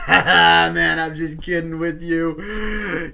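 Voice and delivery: high, loud, laughing jokey guy voice, slow; Sid laughing it off. Retold or imitated. Reviewer imitates Sid laughing off his own insult. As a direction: play the goof who was only joking. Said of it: "Sid just goes".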